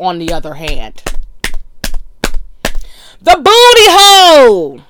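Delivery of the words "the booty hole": The booty hole shouted much higher and louder, drawn out; grand exclamation. Real, not acted. Exalting her topic to listeners, with a loud proclamation. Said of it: "repeats it as a big exclamation".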